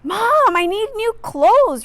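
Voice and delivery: high, loud pleading whine; whiny cry. Acted. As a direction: beg Mom like a whiny kid. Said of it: "Rigo cried".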